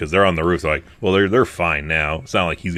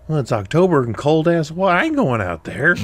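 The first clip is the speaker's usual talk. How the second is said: exasperated; 8 semitones higher than usual. Retold or imitated. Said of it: "I'm like".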